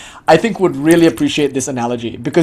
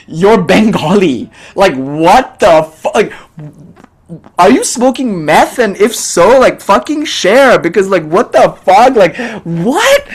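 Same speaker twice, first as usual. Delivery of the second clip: slow, high, louder exasperated outburst; incredulous disbelief; 5 semitones higher, 4 dB louder, slower than usual. Retold or imitated. Speaker stunned by the donor's reaction, slow, loud incredulity. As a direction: stretch it out in total disbelief.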